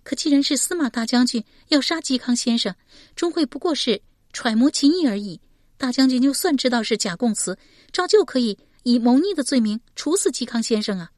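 high-pitched, thoughtful character voice; worried questioner. A narrator's reading. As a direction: lift the voice high and raise a concern. Voices Shi Pei objecting.

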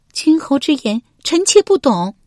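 high female character voice; high-pitched wife denying all knowledge; noblewoman feigning ignorance. Acted. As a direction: play innocent in a high lady's voice.

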